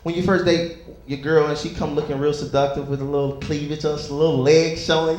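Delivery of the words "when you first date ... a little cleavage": usual speaking voice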